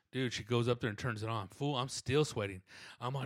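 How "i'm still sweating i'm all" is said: ordinary talk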